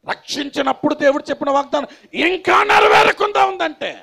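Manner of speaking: high, full-force pulpit shout; shouted preaching. Real, not acted. Preacher confronts believers over God's unfulfilled promise, shouting. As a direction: build to a ringing shout.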